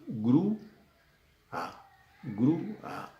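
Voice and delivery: "grúa" split by a pause, grú... a; syllable by syllable. Shown as a demonstration. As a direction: pull "grúa" apart at the vowels. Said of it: "la U acentuada se va a una sílaba".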